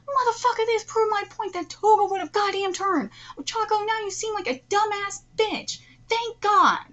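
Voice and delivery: high, loud indignant rant; fired-up, scolding outburst; heated vindication. Real, not acted. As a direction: throw it in the doubters' faces. Heated rant at doubters and character.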